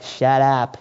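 put-on low, soft Boston-accented aside. Preacher paraphrasing Paul to the Ephesian church, Boston accent. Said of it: "in a Boston accent".

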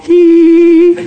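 high-pitched imitated child giggle; little giggle. Hiding daughter reacting to dad, giggling. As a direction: squeal a tiny high "hee". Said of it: "I get a little giggle".

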